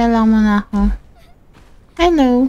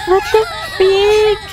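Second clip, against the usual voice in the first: higher, slow, drawn-out shocked cry; freaked out; 6 semitones higher, slower than usual. Real, not acted. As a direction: stretch it out in disbelief. Horror at game event. (takot at gulat, mabagal at mataas)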